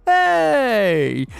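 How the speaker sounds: high, loud, drawn-out "hey"; mock-casual hey. Podcaster imitating Emilio's greeting in the film, mock-casual holler. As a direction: call out a breezy hey, stretched and high. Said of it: "Emilio gives out this casual".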